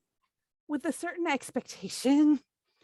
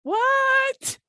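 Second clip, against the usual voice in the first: high, loud startled What; 13 semitones higher, 7 dB louder than usual. Pretend. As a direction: jolt up in alarm. Startled again by the voice.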